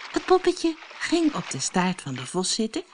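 ordinary delivery, nothing marked

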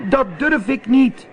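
high, loud pleading cat voice; scared cat calling up to the witch; little cat calling out. A narrator's reading. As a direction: beg the witch from the mast top. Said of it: "riep Zwervertje".

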